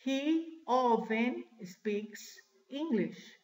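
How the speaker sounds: very slow, pauses between the words; slow model sentence. Shown as a demonstration. Teacher showing class often in a sentence, slow model sentence. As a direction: give learners each word separately.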